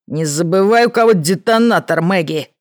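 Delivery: loud threatening outburst; blurted threat. A narrator's reading. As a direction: blurt it out as a warning. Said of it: "выпалил он в ответ".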